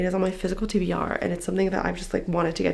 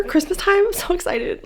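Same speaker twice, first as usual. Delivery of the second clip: excited; 11 semitones higher than usual. Real, not acted. Excited about seeing friend.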